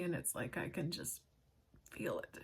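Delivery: a hushed, overwhelmed whisper. Real, not acted. Telling viewers how the books feel, in a hushed whisper. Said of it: "it's powerful".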